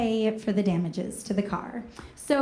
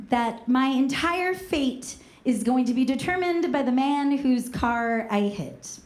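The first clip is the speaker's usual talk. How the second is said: ordinary delivery, nothing marked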